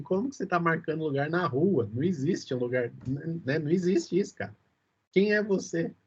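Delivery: ordinary talk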